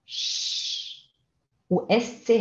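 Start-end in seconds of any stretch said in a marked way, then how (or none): 0.1-1.1 long hushing sh, lips pushed forward; sh sound. Shown as a demonstration. Teacher demonstrates the German sch sound. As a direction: push the lips out and hiss sh. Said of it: "Quando você tem S C H".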